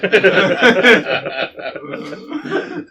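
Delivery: high laughing fit. Real, not acted. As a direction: break up laughing. Laughing at slip.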